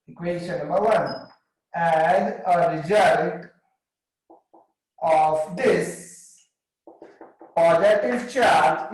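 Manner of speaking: words spaced out for students to write down; dictating each phrase slowly so the class can copy it; slow dictation. Shown as a demonstration. Teacher dictating notes to students, slow and spaced.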